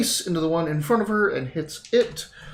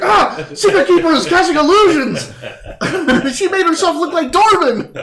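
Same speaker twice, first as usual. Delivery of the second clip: much higher, loud frantic alarm; panicked shout; 10 dB louder than usual. Acted. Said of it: "you're like".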